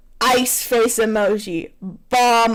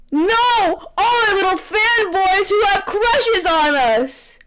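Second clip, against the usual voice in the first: higher, mock-anguished cry; dramatic exclamation; 7 semitones higher, slower than usual. [name] exclaiming about lost fans, high and anguished. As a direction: wail over lost fans. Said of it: "he exclaims".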